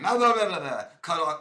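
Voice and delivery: loud, high mock blah-blah chatter; mock blabbering. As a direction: drown them out with mocking blah-blahs. Mocking someone who talks over you.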